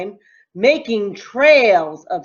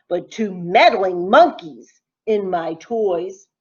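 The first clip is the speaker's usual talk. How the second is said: ordinary delivery, nothing marked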